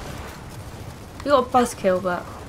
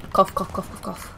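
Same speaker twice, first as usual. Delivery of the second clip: mock coughing words; 3 semitones lower, 4 dB quieter than usual. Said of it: "saying cough instead of coughing".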